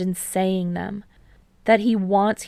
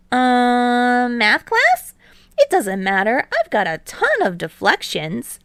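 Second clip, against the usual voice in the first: put-on higher, louder boyish teammate; Hinata, eager; 4 semitones higher, 6 dB louder than usual. A narrator's reading. Hinata bragging to Kei about deflections, higher, louder and eager. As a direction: bounce up, brag a bit.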